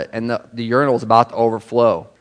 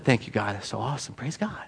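hushed relieved thanks, much softer; quiet thanks; 3 semitones lower, 12 dB quieter than usual. Retold or imitated. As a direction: breathe out thanks after the call. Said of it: "I said".

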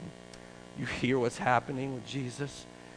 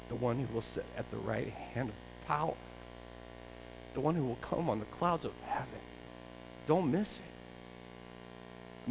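hushed solemn reading; 2 semitones lower, 8 dB quieter than usual. Acted. Solemn reading of Jesus' claim.

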